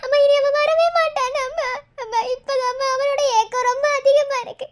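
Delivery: crying as she speaks; tearful lament. Acted. Daughter tells mother he will never come, crying. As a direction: sob the words out to a parent.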